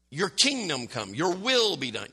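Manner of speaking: KINGDOM and WILL punched, YOUR thrown away; stress on KINGDOM and WILL. Shown as a demonstration. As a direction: hit kingdom and will, skim over your. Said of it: "We do exactly what I just did".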